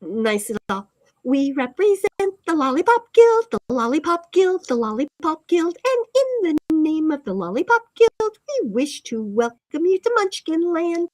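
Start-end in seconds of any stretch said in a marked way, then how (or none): 1.2-11.1 higher, louder sing-song Munchkin chant. Shown as a demonstration. Streamer redoes the Munchkin line, sing-song and high. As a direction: pipe it out like a Lollipop Guild munchkin. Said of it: "I didn't read that nice at all".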